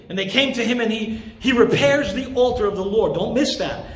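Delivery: ordinary delivery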